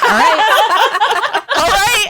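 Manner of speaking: laughing mock Kate. Kate giving in to Anthony, laughing and high. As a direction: agree while cracking up. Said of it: "she's like".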